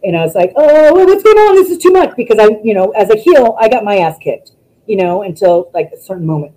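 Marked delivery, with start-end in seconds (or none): 0.6-2.2 high, loud mock panic; loud, high-pitched overwhelmed outburst. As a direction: re-enact flustered alarm. Retelling being overwhelmed in the ring.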